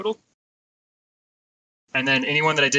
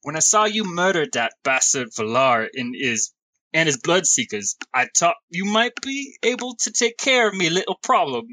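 shaky attempt at an English smallfolk accent; rough English accent; 2 semitones higher than usual. Game master voices Uncas to the party, rough English accent. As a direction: put on an amateur English accent. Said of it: "I'm terrible at accents".

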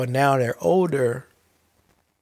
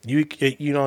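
normal talking voice

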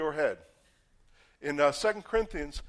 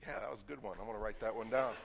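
self-satisfied inner thought; 6 semitones lower, 10 dB quieter, faster than usual. Retold or imitated. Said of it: "I'm sitting there".

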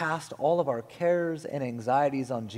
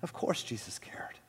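very soft, near-whisper; quiet, soothing narration; hushed reassurance; 8 dB quieter than usual. A narrator's reading. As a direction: drop to a gentle hush. Reassurance after yelled plea.

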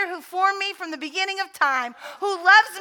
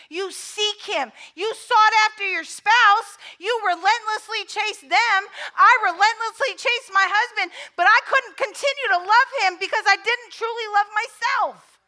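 impassioned preaching; 4 semitones higher, 4 dB louder than usual. Real, not acted.

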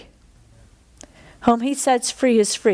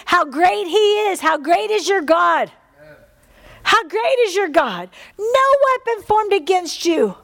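preaching climbing higher in fervor; rising praise; 6 semitones higher than usual. Acted. Preacher exalting God to the church, rising fervent praise. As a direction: lift the congregation higher and higher.

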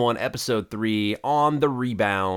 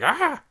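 a sudden, very high shriek of fright; mock panicked scream, high pitched; frightened scream. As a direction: yelp like a man who sees a big biker. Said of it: "He's like".

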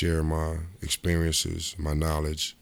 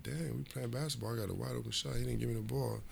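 higher, softer muttered complaint; muttering to himself; 7 semitones higher, 10 dB quieter than usual. Retold or imitated. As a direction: grumble under your breath. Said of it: "So I'm like".

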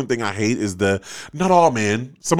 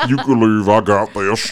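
lightly put-on straight guy; mock-confident dude sending someone off; straight man voice. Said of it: "your straight man voice".

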